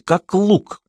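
plain reading voice

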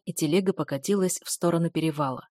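ordinary delivery, nothing marked

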